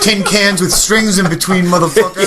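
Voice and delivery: loud, high comic taunt; hammed-up yelled threat; mock threatening rant. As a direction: shout it as a mock-angry punchline. Continuing the comic phone threat.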